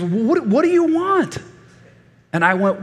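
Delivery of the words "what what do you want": high, drawn-out mock indignation; exasperated kid. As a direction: imitate a child treating grandpa as stupid. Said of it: "like I was stupid".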